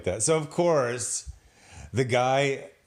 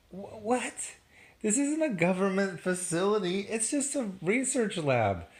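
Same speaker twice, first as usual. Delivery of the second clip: incredulous disbelief; 8 semitones higher than usual. Real, not acted. Incredulous at the absurd heist.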